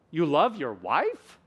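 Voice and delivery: dip, then soar on "wife"; question rise. Shown as a demonstration.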